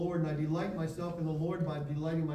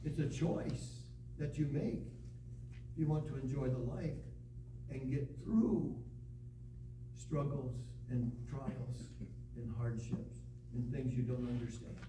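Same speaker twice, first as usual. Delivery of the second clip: softer appeal; 4 semitones lower, 8 dB quieter than usual. Acted. Preacher drops to intimate appeal.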